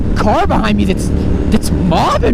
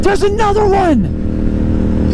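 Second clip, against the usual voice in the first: awed; 10 semitones higher, much slower than usual. Real, not acted. Awed at waterfall.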